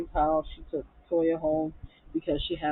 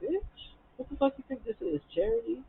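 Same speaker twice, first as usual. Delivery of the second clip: high-pitched mock disbelief; indignant questioning, put on for a retelling; incredulous imitation; 7 semitones higher than usual. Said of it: "Fallon looked at her like".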